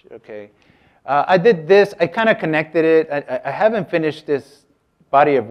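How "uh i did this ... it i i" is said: usual speaking voice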